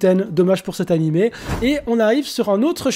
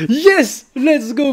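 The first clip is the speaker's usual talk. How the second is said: loud, high-pitched celebration; triumphant shout; excited cheer; 6 semitones higher, 5 dB louder, much slower than usual. Real, not acted. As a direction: burst out cheering at a win. Celebrates the vote result.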